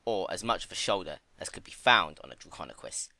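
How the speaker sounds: plain reading voice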